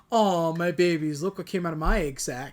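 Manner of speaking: quoted film character. Retold or imitated. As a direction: play up the movie line. Quotes Sonic film line, played up.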